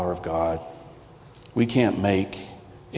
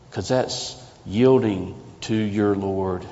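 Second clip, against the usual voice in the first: ordinary talk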